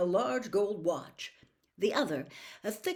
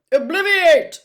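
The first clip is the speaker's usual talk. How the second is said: much higher, very loud spell cast; sharp spell; 11 semitones higher, 19 dB louder, slower than usual. Acted. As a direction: snap the spell out like a command. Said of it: "he said sharply".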